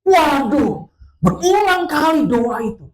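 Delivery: loud, high-pitched preaching cry; exasperated exclamation. Acted. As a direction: exclaim at the endless repetition. Preacher exclaims over repeated prayer. (seruan lantang bernada tinggi)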